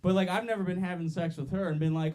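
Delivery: normal talking voice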